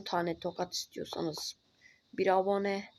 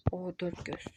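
hushed whisper, hiding; whispering while hiding; 2 semitones lower, 5 dB quieter than usual. Real, not acted. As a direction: whisper so she won't hear.

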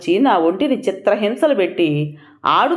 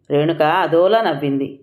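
usual speaking voice

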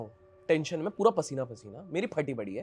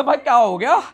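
loud, higher alarmed call; alarmed roommate; 7 semitones higher, 9 dB louder than usual. Retold or imitated. Roommate shouting to the screaming speaker, loud and alarmed. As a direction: shout it like a startled roommate woken at 3 am. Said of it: "बोला".

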